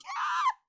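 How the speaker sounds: short, very high, loud scream; startled shriek. A narrator's reading. Girl as something barrels at her face, startled shriek. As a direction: shriek in sudden fright. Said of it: "I shriek".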